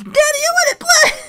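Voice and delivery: put-on a tearful child begging her dad; Nanako whining. Imitating Nanako begging her daddy to play, high crying shout. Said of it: "my favorite line".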